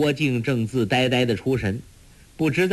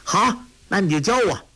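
put-on high, eager child's cry of joy; a kid thrilled to get his wish; boy overjoyed at the offer; delighted boy; 8 semitones higher than usual. A narrator's reading. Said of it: "托雷大喜".

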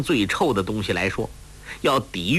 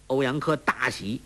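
plain reading voice

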